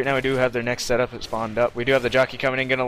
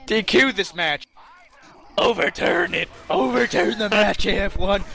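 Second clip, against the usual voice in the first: mock outrage; 8 semitones higher than usual. Joking demand to overturn match.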